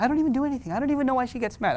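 put-on innocent kid. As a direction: plead ignorance lightly. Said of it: "You're so innocent".

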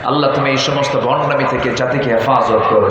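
ordinary talk

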